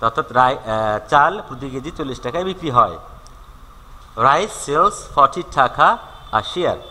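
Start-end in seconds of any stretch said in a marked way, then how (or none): 4.3-6.8 slow, deliberate dictation of the sentence; slow example read. Shown as a demonstration. Teacher reading an example to students, slowly. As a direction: read it out word by word. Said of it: "teacher reads example sentence slowly".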